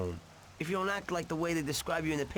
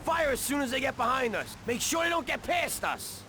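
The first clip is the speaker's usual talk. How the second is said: high-pitched shouted-over commands, rising as it goes; urgent orders; 9 semitones higher than usual. Acted. Driver orders his partner to fire at pursuers, urgent orders. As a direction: call out battle orders, pitch climbing high. Orders during car chase.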